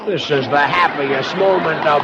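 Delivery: very slow, dry mock rapture; long-suffering husband's dry sarcasm; sarcastic deadpan. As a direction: lay the irony on thick and slow. [name] deadpans about the turkey.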